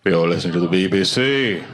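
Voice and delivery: low, plummy British announcer voice, imitated; mock BBC announcer. Comic imitating BBC radio announcer, mock British accent. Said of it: "I do listen to B B C News".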